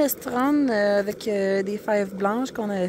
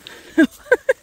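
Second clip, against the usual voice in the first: éclat de rire aigu et fort; 13 dB louder than usual. Real, not acted. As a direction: rire spontané, surpris. Rire en voyant la passerelle. (rire aigu)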